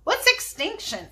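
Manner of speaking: high-pitched puzzled question; curious questioner, pitched well up; Alfred Gore. A narrator's reading. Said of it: "asked Alfred Gore".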